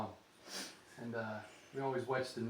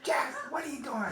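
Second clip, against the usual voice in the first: high, loud frantic yelling across the yard; panicked shout; 14 semitones higher, 7 dB louder than usual. Retold or imitated. Grandma shouting at grandpa over the shotgun, panicked. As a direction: yell at him like he's lost his mind.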